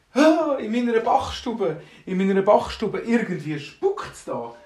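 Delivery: loud, high, frightened baker; very scared. Acted. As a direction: gasp it out slowly in acted alarm. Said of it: "ich hab mega Angst".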